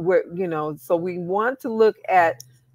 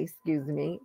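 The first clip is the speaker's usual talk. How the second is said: normal talking voice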